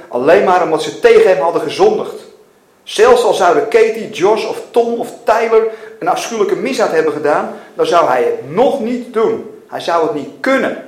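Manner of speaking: loud, emphatic protest; vehement refusal. A narrator's reading. Father refusing to condemn his children, vehement. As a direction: dig in firmly, stressing key words.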